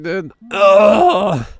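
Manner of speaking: ordinary delivery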